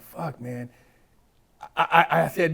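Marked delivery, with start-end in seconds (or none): 0.0-0.7 dejected mutter. Retold or imitated. Retells sinking feeling in jail.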